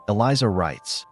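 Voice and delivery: ordinary delivery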